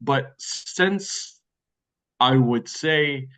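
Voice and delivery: ordinary delivery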